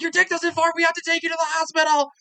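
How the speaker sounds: high, fast, frantic imitated girlfriend; mock panicked crying. Girlfriend begging Jake to see a doctor, high frantic mock panic. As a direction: squeal it fast like she's about to cry. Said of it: "She's crying".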